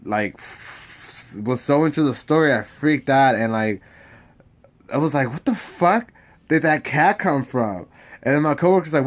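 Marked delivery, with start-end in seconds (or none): 5.3-7.9 startled outburst. Retold or imitated. Retold freak-out.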